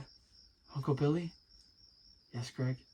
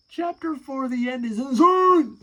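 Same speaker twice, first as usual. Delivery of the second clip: "Chapter four, the end" yelled much higher and louder; mock outrage, shrieking "Chapter four, the end is insane"; incredulous shout; 13 semitones higher, 12 dB louder than usual. Shown as a demonstration. Mocking his own childhood book, loud high shout. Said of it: "Who makes a chapter to end the story?".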